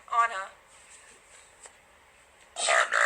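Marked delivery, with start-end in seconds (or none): 2.6-3.1 name belched out deep; burping the words. Shown as a demonstration. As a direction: burp the name Anna. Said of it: "Can you burp my name?".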